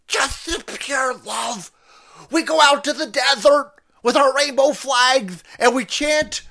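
high, slow dreamy mock chant; mocking hippie. Mocking rainbow-flag crowd chanting to the sky, high and dreamy. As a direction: swoon it out like a blissed-out hippie.

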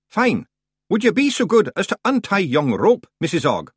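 rally the Feegles, Scottish; Scottish call. Acted. Rob Anybody asks Nanny.

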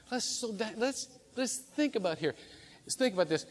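soft, measured coaxing; calm them down without raising your voice; hushed appeal to think it over; restrained, not yelling. Acted. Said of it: "a coach who didn't yell".

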